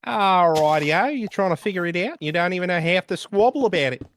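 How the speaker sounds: put-on British accent. Streamer joins the British joke for her friends, in a hammed-up cockney accent. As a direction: do a cartoon cockney bloke, all swagger. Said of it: "The British are infecting me".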